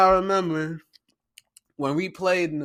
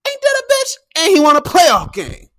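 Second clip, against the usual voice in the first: high, loud hyped gloat; 14 semitones higher, 8 dB louder than usual. Real, not acted. Gloating to listeners about the win, loud high crow. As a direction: crow it triumphantly.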